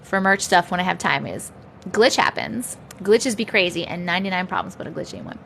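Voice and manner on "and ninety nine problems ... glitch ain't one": trailing mumble. Real, not acted. Fading into a mumble.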